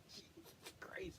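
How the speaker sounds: slow, breathy wheeze through laughter; barely voicing it, spent from laughing; whispered laugh. Real, not acted. Exhausted after a laughing fit, whispered.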